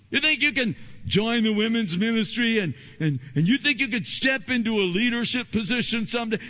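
bully them till they quit; jeering enemy. Retold or imitated. The enemy shouting down the would-be leader, loud jeer. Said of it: "Sanballat said".

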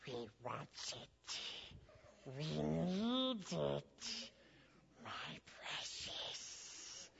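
slow, hushed, strange creature hiss; Gollum voice. Retold or imitated. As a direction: crave it, drawing each word out. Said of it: "he says some creepy things".

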